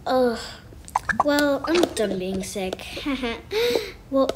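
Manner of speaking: ordinary talk, nothing marked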